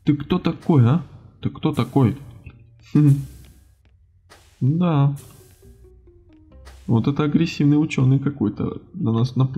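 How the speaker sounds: normal talking voice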